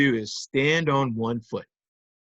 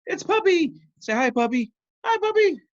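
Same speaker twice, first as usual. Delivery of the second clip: high, squeaky puppy voice; puppy puppet voice; 5 dB louder than usual. Pretend. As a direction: play a tiny excited puppy.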